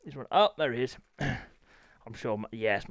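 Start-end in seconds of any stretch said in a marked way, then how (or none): none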